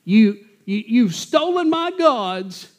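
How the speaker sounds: high, slow, whining complaint; whiny fella. Retold or imitated. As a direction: whine it like a pitiful man. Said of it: "this mealy-mouthed, whiny fella".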